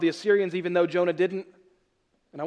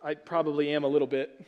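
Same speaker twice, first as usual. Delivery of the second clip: ordinary talk, nothing marked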